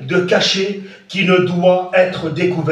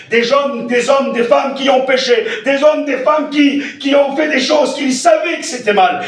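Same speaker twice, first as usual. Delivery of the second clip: preaching shout; 7 semitones higher, 3 dB louder than usual. Acted. Preacher crescendo.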